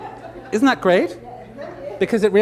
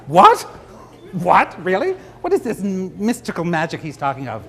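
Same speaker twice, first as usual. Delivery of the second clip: loud mock amazement on What; mock disbelief; 2 semitones higher, 6 dB louder than usual. Florist voicing the gobsmacked audience in mock disbelief. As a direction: play the stunned audience. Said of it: "audience reaction voiced".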